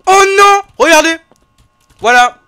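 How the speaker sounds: high, loud frightened shout; scared horror game streamer. Real, not acted. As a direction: shriek in fright.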